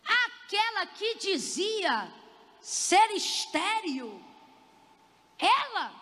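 high, very slow teasing delivery; goading. Acted. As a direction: stretch each phrase to stir up curiosity. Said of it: "olha como é que ele instiga ela".

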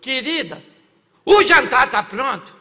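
loud, slow call up close; mock husband shouting for his wife. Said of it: "Aproximou de novo e falou".

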